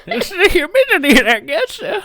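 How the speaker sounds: high, hammed-up folksy old-timer; mock a know-it-all grandpa, way up high; silly old-man voice. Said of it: "I don't know where that voice came".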